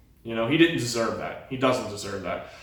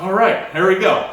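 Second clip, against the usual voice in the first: higher and louder, fired up; pumped up; 7 semitones higher, 7 dB louder than usual. Retold or imitated. Athlete who just made finals, pumped and loud. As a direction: let the relief burst out as you gear up.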